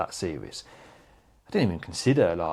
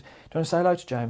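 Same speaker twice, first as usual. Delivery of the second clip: high, quick, chirpy question; bright assistant voice; 8 semitones higher, faster than usual. Retold or imitated. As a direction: ask it like a perky assistant. PA asking him brightly.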